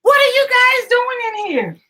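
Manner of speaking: high loud startled mom; mother bursting in, shrieking; mother screaming. Acted. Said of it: "I heard my mother scream".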